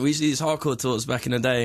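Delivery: usual speaking voice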